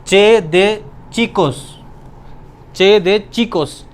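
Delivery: slow, deliberate teacher delivery; drawn out so the class can follow; careful slow dictation. Shown as a demonstration. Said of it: "इसको ये क्या बोलते हैं?".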